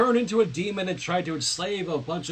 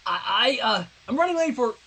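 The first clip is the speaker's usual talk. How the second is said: fast, tripping over the words; nervous stammer; 5 semitones higher than usual. Acted. Sunset flustered in front of Twilight, stammering fast. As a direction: blurt it out flustered. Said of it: "Sunset stammered".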